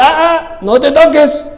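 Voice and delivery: high, urgent cry to stop; Paul stopping the jailer from killing himself, urgently; urgent warning. Retold or imitated. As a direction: pitch it up to stop him in time. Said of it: "en ese momento Pablo dice".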